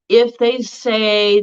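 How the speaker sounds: ordinary delivery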